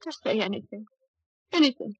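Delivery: ordinary talk, nothing marked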